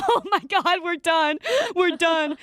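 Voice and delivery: mock panic. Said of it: "And we were like".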